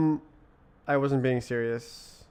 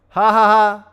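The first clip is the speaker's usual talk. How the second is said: big loud laugh, higher and louder; 8 semitones higher, 16 dB louder than usual. Real, not acted. Laughing at his own joke about accents, loud laughter. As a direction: burst out laughing.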